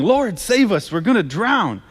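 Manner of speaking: high-pitched cry for help, read aloud; narrated plea, pitched well up; mild shout. A narrator's reading. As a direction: read it as a restrained shout to be saved.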